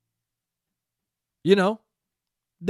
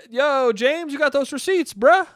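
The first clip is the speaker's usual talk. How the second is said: higher and louder, cheering a friend on; hyped cheer; 8 semitones higher, 6 dB louder than usual. Retold or imitated. Cheering James on as a fan, hyped and loud. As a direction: egg him on like a hype man. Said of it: "I was like".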